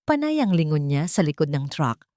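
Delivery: usual speaking voice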